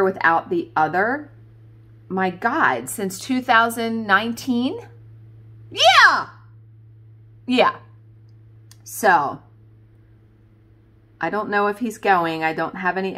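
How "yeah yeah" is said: high, loud, falling exclamation; a big, high-pitched yeah; emphatic amazement. Real, not acted. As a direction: confirm it in real amazement. Amazed at years never apart.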